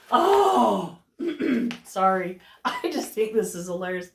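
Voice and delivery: ordinary delivery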